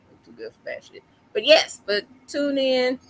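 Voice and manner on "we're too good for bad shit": unvoiced whispered joke; whispered aside. Real, not acted. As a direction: whisper it under your breath.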